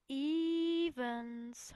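lightly voiced Potter, teasing; a hint of a smug boy; Potter calling. Potter calls Lily by her surname, teasing. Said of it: "hörte ich Potter sagen".

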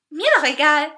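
high, loud sing-song; breezy trilled brush-off; trilling. A narrator's reading. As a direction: chirp it carelessly in a lilting voice. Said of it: "trällerte er".

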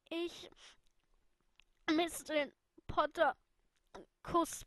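high, broken words between sobs; choked out through tears. Acted. Lily, crying, tries to answer Alice. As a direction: force each word out, crying.

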